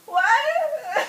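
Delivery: high, loud incredulous cry; hammed-up astonishment; shocked. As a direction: blurt it in mock disbelief. Acts out shock at sudden labour.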